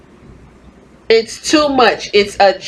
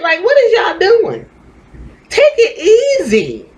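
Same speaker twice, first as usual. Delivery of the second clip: much higher, half-crying whine; mock crying plea; 11 semitones higher than usual. Pleading with rude wrestling fans, mock crying. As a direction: plead like you're about to break down.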